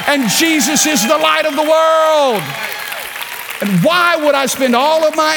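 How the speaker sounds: rising from strong to full shout; building preaching shout. Real, not acted. Pastor tells the church his hope is Jesus, building to a shout. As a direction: build each line to a ringing shout.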